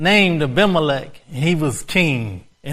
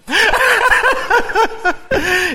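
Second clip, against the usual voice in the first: high loud burst of laughter; delighted laugh; 4 dB louder than usual. Real, not acted. Preacher laughing at what the Holy Spirit told him.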